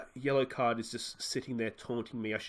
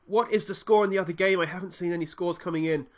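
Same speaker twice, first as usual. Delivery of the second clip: ordinary delivery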